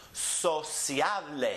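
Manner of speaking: slow Spanish Sociable, so-SYA-bleh; next-to-last stress. Shown as a demonstration. Teacher models for students, Sociable slowly stressed in the middle. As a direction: draw out Sociable, stressing the CIA. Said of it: "it's the next to last syllable that gets emphasized".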